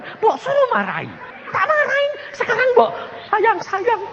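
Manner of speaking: high, aggrieved comic complaint; mock-indignant high protest. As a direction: whine upward at being treated unfairly. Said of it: "Aku protes".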